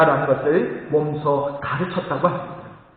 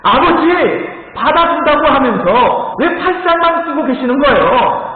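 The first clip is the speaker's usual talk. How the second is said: higher, louder hurt complaint; angry protest; 6 semitones higher, 4 dB louder than usual. Retold or imitated. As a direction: protest like a boy who was let fall. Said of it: "화를 내면서".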